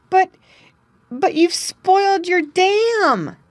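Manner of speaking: stammering in disbelief; shocked. A narrator's reading. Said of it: "cried Jerry".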